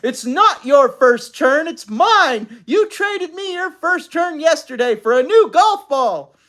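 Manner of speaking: loud, high, indignant yell. A narrator's reading. Mother pounding on the bathroom door, yelling. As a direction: yell it in protest.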